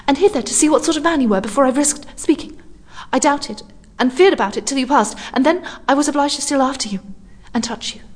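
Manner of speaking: a frightened stranger, barely acted; lightly voiced young woman. A narrator's reading. Lone woman to a stranger, nervous and hushed.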